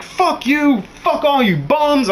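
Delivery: high angry swearing; cussing mad. Retold or imitated. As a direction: curse out the whole crowd. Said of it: "cussing mad".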